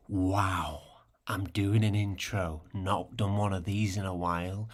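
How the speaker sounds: ordinary talk, nothing marked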